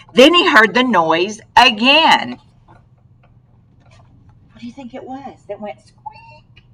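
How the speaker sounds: plain reading voice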